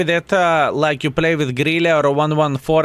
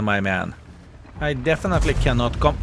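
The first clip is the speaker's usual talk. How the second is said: usual speaking voice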